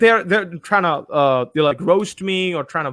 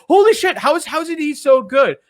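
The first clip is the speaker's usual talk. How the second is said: mock amazement; 8 semitones higher than usual. Host mimics haters wondering at his skill, mock amazement. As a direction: imitate an envious hater's astonishment. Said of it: "That's why you go like".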